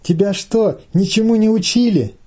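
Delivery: high-pitched indignant call; crying out. A narrator's reading. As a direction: call it out reproachfully from the veranda. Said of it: "выкрикивает от веранды Саня".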